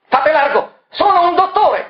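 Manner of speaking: high, loud commanding shout. Acted. Dr Moroni calling out to the crowd, high loud shout. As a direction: push through the crowd calling out. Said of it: "gridò Moroni".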